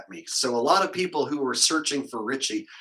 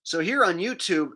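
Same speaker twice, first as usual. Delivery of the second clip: normal talking voice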